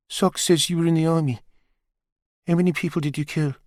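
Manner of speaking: put-on a boy blurts a blunt question; lightly voiced teen boy, flat and direct; teenage boy Perry. A narrator's reading.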